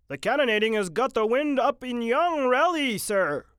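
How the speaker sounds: high, loud soldier's report; urgent sergeant. Acted. Sergeant reporting to Captain Mitty, high, loud and urgent. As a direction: report it urgently over the guns.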